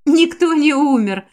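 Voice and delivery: usual speaking voice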